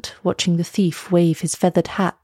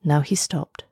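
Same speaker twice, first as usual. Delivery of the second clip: usual speaking voice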